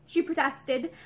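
usual speaking voice

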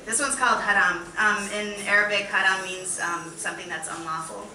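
ordinary talk, nothing marked

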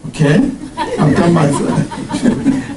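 ordinary talk

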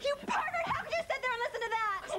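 high-pitched, shrieked accusation; outraged. Acted. Woman to the man who overheard her call, outraged. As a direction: scold him at the top of your range.

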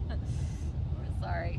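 breathy laughing apology. Real, not acted.

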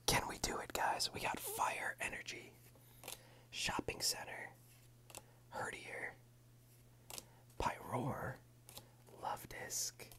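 soft close whisper throughout; ASMR whisper. Real, not acted. ASMR host whispering through a pack opening. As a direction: whisper it all for the ASMR channel.

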